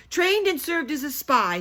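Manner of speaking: proud, booming announcement; loud and proud. Acted. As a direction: declare it with pride. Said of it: "loudly and proudly".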